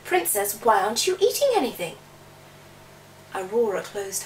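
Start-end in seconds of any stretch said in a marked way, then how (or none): 0.0-2.0 chirpy frog. Acted.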